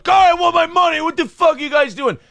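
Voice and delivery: high, loud yelling about his money; mock hothead shouting at clerks; angry yell. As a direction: shout like a guy losing it. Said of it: "If there's a guy going".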